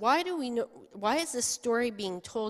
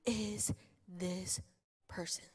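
awed whisper; slower than usual. Acted. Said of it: "And they're like".

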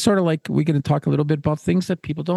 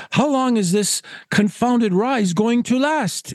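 slow, higher-pitched pompous complaint; exasperated Caswell; 7 semitones higher, slower than usual. Acted. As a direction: drag out the question like a put-upon gentleman.

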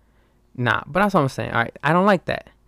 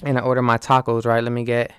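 normal talking voice

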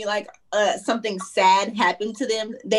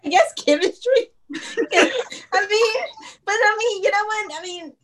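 laughing through words; 8 semitones higher than usual. Real, not acted. Laughing while choosing chemistry.

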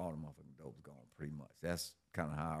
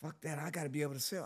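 higher, mocking imitation of her; mocking girlfriend; 9 semitones higher, faster than usual. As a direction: throw her words back at her, higher.

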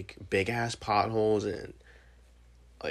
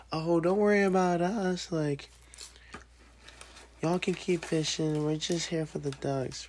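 put-on lightly raised old lady voice; 9 semitones higher than usual. Old lady telling fishermen not to worry, light high imitation. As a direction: mimic a cheerful old lady, a bit higher. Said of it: "all of a sudden I hear".

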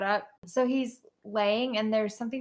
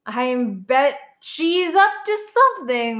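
loud, higher, accusing note-reading; emphatic suspicion; 4 semitones higher, 8 dB louder than usual. Acted. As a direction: call out your suspicion loudly. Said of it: "my notes are like".

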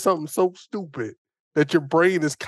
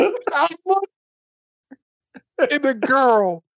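laughing; 12 semitones higher than usual. Real, not acted. Laughing while retelling absurd story.

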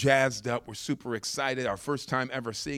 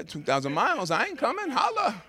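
joking refusal; 10 semitones higher than usual. Retold or imitated. Jokes about refusing the pickup.

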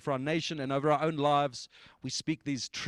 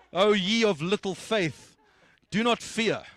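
mock scripture quote; 5 semitones higher, 5 dB louder, slower than usual. Playfully quoting scripture to congregation.

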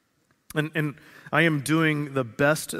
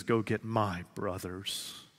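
softer and slow, tender; gentle; 4 semitones lower, 8 dB quieter, slower than usual. Acted. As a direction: say it softly, like a loving elder brother. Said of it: "He says".

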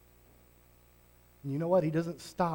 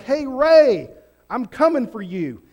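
louder, higher hail to a friend; Jesus calling out; 6 semitones higher, 10 dB louder than usual. Acted. Jesus calling out to Ray, hailing him loud and high. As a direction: call him by name across the room. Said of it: "He literally was like".

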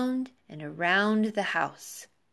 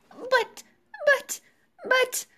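high, stammering cry of alarm; distressed cry; slower than usual. A narrator's reading. Wife seeing her shrunken, winged husband, high distressed cry. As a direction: squeak it out, stuck on the word. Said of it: "cried Missus Gregg".

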